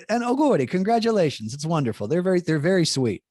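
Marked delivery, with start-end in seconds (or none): 0.1-2.1 high-pitched delighted well-wishing, settling down; gushing congratulations. Retold or imitated. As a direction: imitate sweet colleagues congratulating him. Acting out colleagues' sweet congratulations.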